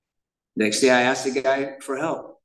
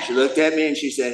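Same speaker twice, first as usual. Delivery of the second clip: normal talking voice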